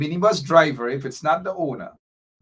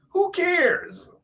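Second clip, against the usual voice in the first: much higher, mocking "Who cares?"; mock the commenter's "Who cares?" in a squeaky, high voice; mocking quote. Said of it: "what he means by".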